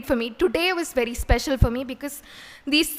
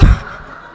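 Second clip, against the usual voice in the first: quick breathy unvoiced chuckle; a real little laugh breaks out; embarrassed breathy chuckle; short laugh; 7 dB louder than usual. Real, not acted. Embarrassed laugh mid-sentence.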